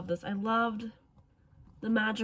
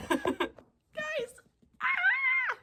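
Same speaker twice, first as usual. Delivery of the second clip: high thrilled squeal of joy; sudden shriek of excitement; excited squeal; 3 dB louder than usual. Real, not acted. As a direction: burst out with delight. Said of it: "I'm so excited".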